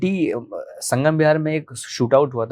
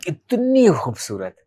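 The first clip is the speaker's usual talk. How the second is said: slow, raised, long-drawn इतनी; awestruck admiration; 5 semitones higher, much slower than usual. Real, not acted. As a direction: dwell on इतनी in smitten wonder. Gushing over first sight of girl. (इतनी को लंबा खींचते हुए, हैरत भरी तारीफ़)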